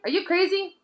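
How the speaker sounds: high, disbelieving question; incredulous. A narrator's reading. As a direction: toss it back, high and amazed. Harry disbelieving Hermione.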